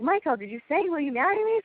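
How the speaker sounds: high-pitched imitation of relatives checking, climbing at the end; prompting question. Family to Michael who skipped the question, prompting. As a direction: nudge him with a rising question. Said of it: "and they're like".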